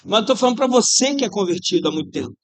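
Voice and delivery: normal talking voice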